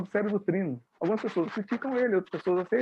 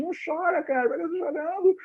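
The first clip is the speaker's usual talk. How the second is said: scolding inner voice; 9 semitones higher than usual. Acted. Inner voice talks to a crying film viewer, high mock reproach. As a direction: play the inner voice telling someone to stop crying. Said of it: "o irracional dele tá falando assim".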